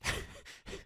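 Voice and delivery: breathy little laugh; soft laugh. Real, not acted. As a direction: chuckle at own joke. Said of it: "Sometimes I crack myself up".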